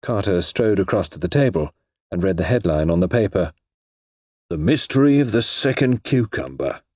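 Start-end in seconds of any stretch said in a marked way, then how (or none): 4.5-6.8 mildly gloomy title reading, pitched up; gloomy announcement. A narrator's reading. Detective reading a headline on the paper, mildly gloomy. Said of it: "announced in a gloomy voice".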